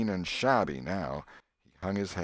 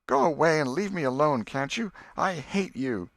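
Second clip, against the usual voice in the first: much higher, louder and tearful; tearful anger; 9 semitones higher, 5 dB louder than usual. Acted. Becky telling Alfred to leave, hurt and angry. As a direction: drive him away in hurt anger.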